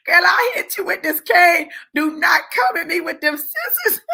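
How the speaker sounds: high, laughing mock warning; hammed-up high-pitched threat; retold panicked threat. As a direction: act out his alarmed warning through laughter.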